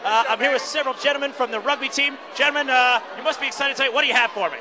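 loud, eager awards host; red carpet host. Acted. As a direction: shout it over the crowd. Red carpet host pre-show.

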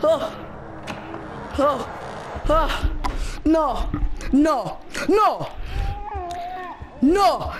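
high-pitched, panicky oh's and no's; scared denial, pitched high; frightened gasps. Real, not acted. Panicking after jump scare.

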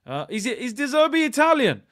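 high, loud incredulous question; shocked disbelief. Real, not acted. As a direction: blurt it out, stunned by the news. Sudden shock at finding out.

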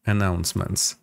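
normal talking voice

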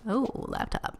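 normal talking voice

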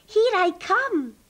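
high, loud, swooping; excited call. Acted. Rabbit about to slide down, calling high and loud. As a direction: call out as you launch yourself. Rabbit calls before sliding down.

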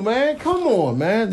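usual speaking voice

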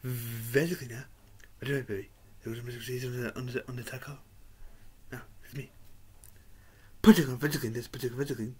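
very soft low murmur; hushed whisper. Retold or imitated. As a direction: murmur it almost silently. Hushed quiet character voice.

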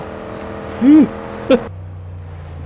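laugh. Real, not acted. Laughs after getting shot down.

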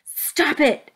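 high, sharp protest; angry shout. A narrator's reading. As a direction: shout to halt them at once. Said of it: "Alexander shouted".

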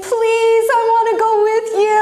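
high, rushed plea; pleading healed man. Retold or imitated. Healed man begging Jesus to take him, eager plea. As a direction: beg eagerly to come along. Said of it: "he's saying".

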